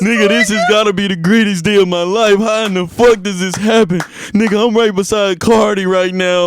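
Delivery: high, hammed-up fan gushing; ecstatic superfan. Friend imitating a fan standing beside [name], overexcited.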